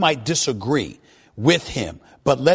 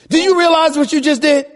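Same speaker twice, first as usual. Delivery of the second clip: high, loud astonished demand; exasperated, pitched-up radio rant; incredulous shout; 12 semitones higher, 6 dB louder than usual. Real, not acted. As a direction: yell it in sheer disbelief. Host astounded by caller's prediction.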